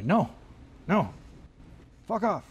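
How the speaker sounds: curt dismissive no; rude brush-off. Pretend. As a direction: brush them off rudely. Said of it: "I'll be rude and just say".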